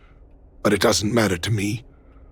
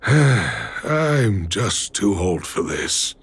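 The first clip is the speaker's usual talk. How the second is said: slow, sighing and worn out; weary sigh; 5 semitones lower, much slower than usual. Acted. Old father sighing to his daughter, slow and weary. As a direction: let the words sag under the years.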